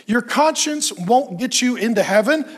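ordinary delivery

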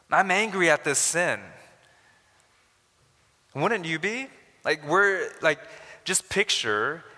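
high, loud, fervent preaching; fiery sermon peak; impassioned preaching. Acted. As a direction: preach it hard to the congregation. Preaching at full force.

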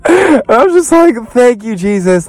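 ecstatic. Real, not acted. Overjoyed reaction to trailer.

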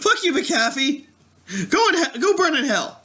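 high-pitched comic fury; high, indignant outburst at a computer; exasperated cursing. Real, not acted. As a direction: curse the noisy software, voice pitched high. Cursing antivirus software for interrupting.

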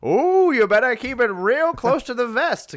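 put-on high old-timey radio gossip. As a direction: ham up a 1950s announcer. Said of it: "anytime that voice starts".

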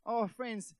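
ordinary delivery

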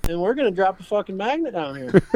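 high, insistent mock demand. Guide imagining he'd force the trip back, mock insistent and high. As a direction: play the pushy client who wants his watch back. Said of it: "I would have been like".

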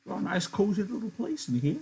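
put-on high old-man voice for Candy, a bit embarrassed. Old Candy, embarrassed, to Crooks, high old-man voice.

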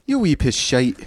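put-on hammy Irish accent, slow; a bad Irish accent put on. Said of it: "Now I'm doing a bad Irish accent".

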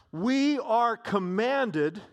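"We are comm-" slowed right down and pressed; a weighty, drawn-out "We are comm-"; slow emphasis. Acted. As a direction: lean hard on each word. Preacher stresses the command.

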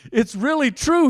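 high, loud, excited exclamation; Peter's amazed realization. Acted. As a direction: burst out as it dawns on you. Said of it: "Peter finally came to his senses".

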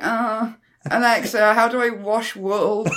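high, slow request to a voice assistant; helpless user. Acted. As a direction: draw it out with mock neediness. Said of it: "And now we're like".

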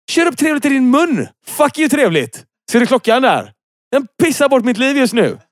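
high-pitched ranting outburst; ranting fury. Acted. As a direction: let the anger explode, voice shooting up. Ranting at a dull coffee. (hög, rasande utbrott)

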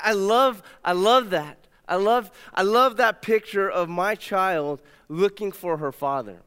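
normal talking voice

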